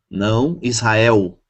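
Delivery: ordinary talk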